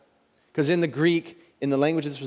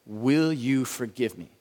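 slow, deliberate plea; 2 semitones lower, slower than usual. Shown as a demonstration. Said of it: "And then the last four".

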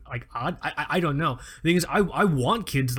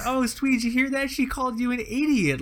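a light sing-song cooing mother, pitched up; hint of a doting mother cooing to her husband; mock sweet mom; 10 semitones higher than usual. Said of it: "the mom's like".

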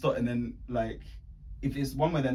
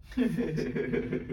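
drawn-out, slightly higher groan of exasperation; exasperated; 4 semitones higher, much slower than usual. Retold or imitated. Driver ordered out of the vehicle, exasperated. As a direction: drag it out, fed up.

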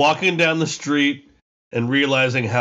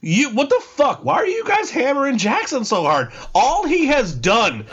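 outraged disbelief; 8 semitones higher than usual. Real, not acted. Outraged at picks against Jackson.

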